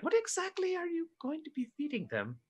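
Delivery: high, soft questioning voice; wary in-character question. Acted. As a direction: ask it high and small. Player questions Chalmers in character.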